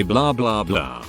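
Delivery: plain reading voice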